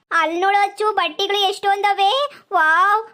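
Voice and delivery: high, loud wide-eyed delight at the dresses; thrilled squeal of wonder; excited gasping. Acted. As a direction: gush over the clothes like a kid in a candy shop. Village girl amazed at clothes.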